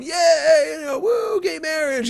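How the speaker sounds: high mock rally whoops; imitating crowd cheer. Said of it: "you can hear everybody going".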